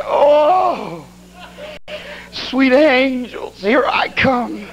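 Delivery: high, long drawn-out cry; stretched-out mock cry of doom; comic wail. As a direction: wail it slowly like a last farewell. Acting his cry before crashing.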